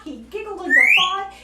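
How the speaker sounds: ordinary delivery, nothing marked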